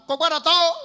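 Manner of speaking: high, loud call back; imitated loud crowd response; crowd's answering shout. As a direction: shout back like eager customers. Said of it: "People go shout".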